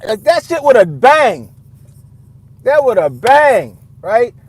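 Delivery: drawn-out, loud and high; loud mock emphasis. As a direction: belt out "with a bang" slowly. Mocking loud bang sound.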